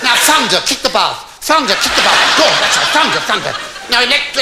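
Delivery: high, loud comic orders; hammed-up excited urging; frantic commanding. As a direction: call out each order in mounting mock frenzy. Husband orders bath storm effects.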